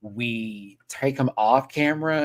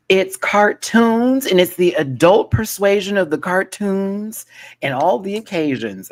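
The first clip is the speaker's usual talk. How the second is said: hyped announcement; 6 semitones higher, 7 dB louder than usual. Real, not acted. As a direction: sell the poll like a host. Announcing the new poll.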